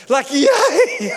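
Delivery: laughing, high cheer of yay; a gleeful, half-joking yay; mock joyful cheer. Preacher cheering being poor in spirit, laughing.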